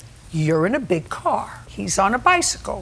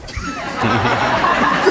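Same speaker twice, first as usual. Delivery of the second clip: laughter. Real, not acted. Judge laughs at own joke.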